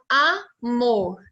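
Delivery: AMOR slowly in two beats, a-mor; split into syllables. Shown as a demonstration. Teacher showing two syllables to children, syllable by syllable. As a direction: break amor after the A. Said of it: "eu tenho duas sílabas".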